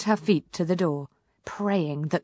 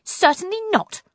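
cross snap; 12 semitones higher, 4 dB louder than usual. A narrator's reading. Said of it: "snapped Poppy crossly".

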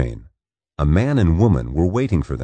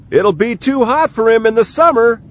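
high shout across the water; 4 dB louder than usual. Acted. Matt on the riverbank shouts to the departing boat, high and loud. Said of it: "he shouted".

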